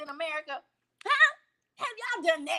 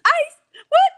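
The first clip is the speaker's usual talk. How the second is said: higher and louder, frantic yelps; panicked mock shriek; 12 dB louder, slower than usual. Mocking immigrants panicking at the word ice, shrieking high. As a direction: shriek like someone startled by ICE. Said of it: "somebody say".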